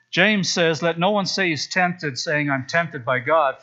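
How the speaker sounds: usual speaking voice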